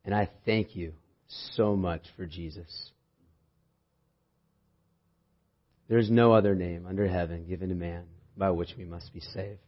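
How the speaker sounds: soft, hushed prayer voice. Acted. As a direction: pray quietly and reverently. Opening prayer before sermon.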